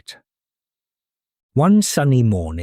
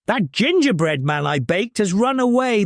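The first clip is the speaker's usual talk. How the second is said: mild shout; 7 semitones higher than usual. Acted. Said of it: "the old woman shouted".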